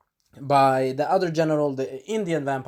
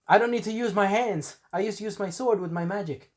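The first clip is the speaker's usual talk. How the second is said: higher-pitched mock boast; lightly voiced boastful fighter; 6 semitones higher than usual. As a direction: show off lightly as a magic swordsman. Acting out Alucard boasting mid-fight.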